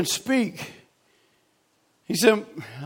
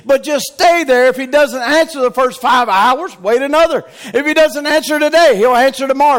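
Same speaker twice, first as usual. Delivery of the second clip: normal talking voice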